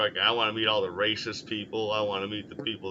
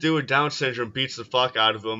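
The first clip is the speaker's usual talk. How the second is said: ordinary delivery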